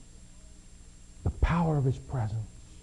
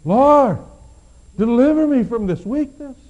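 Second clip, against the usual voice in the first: much louder and higher, begging hard; desperate prayer; 7 semitones higher, 8 dB louder than usual. Acted. Paul prays again, louder and more desperate. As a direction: cry out to God in desperation. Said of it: "He prayed again".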